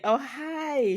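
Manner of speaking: high, bright mock greeting from the mom; surprised mom. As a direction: squeak "Oh, hi" like a flustered mother. Voicing the mom greeting him.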